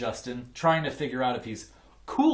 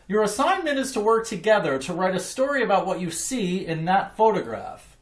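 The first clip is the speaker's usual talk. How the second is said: teacher voice; 8 semitones higher, 5 dB louder than usual. A narrator's reading. Teacher gives class directions.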